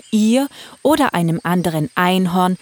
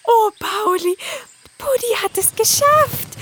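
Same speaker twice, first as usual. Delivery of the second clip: put-on high, happy young unicorn; light with relief; relieved.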